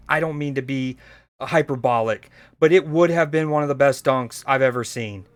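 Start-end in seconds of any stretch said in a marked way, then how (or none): none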